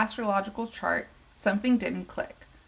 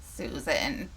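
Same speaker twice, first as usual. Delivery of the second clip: put-on low croaky old lady voice; 6 semitones lower than usual. A narrator's reading. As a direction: croak the name like an old woman. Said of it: "I can't do the old lady voice for more than just Susan".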